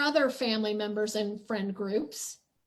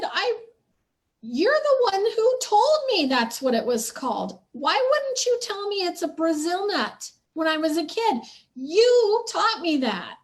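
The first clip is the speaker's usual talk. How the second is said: put-on raised, loud and much higher, retelling the childhood outburst; screaming at family; 6 semitones higher, 5 dB louder than usual. Scolded child screaming back at family who taught her the word. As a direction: shout it at them as the hurt kid. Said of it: "So I started screaming at 'em".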